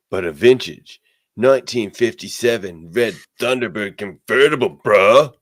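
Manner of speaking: high, loud surfer bro; surfer dude voice. Acted. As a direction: play a laid-back surfer dude. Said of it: "how cool Surfer Havoc would be".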